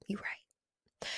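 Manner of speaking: soft whispered concession. Retold or imitated. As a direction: breathe it out as a quiet admission. Conceding to friends' advice.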